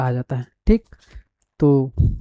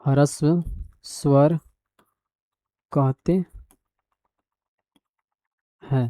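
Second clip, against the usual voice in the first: slow, word-by-word dictation, softer; 4 dB quieter, much slower than usual. Shown as a demonstration. Teacher dictating the definition to students, slow and measured. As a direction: spell it out slowly so students can copy.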